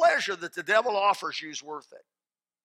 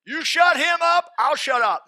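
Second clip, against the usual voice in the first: louder, higher, slow, hammered out; defiant shout; 12 semitones higher, 12 dB louder, slower than usual. Retold or imitated. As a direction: shout it back slowly, word by word. Repeats retort louder.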